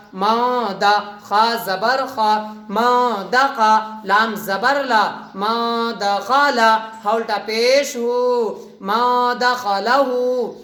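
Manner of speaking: ما دا خا لا ہو spelled out very slowly with nasal hum; slow nasal reading. Shown as a demonstration. Teacher spelling a word for students, slow nasal reading. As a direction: drag each syllable of ما دا خا لا ہو through the nose. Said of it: "آواز آ رہی نا؟".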